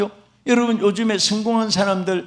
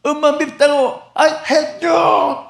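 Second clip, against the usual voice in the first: put-on child complaining; 6 semitones higher than usual. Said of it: "하고 소리쳤더니".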